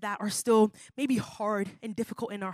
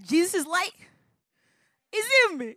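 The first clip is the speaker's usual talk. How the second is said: much higher and louder, rising with energy; excited call-and-response; 9 semitones higher, 8 dB louder than usual. Shown as a demonstration. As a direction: pump up the crowd as they repeat it. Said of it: "Everybody say that".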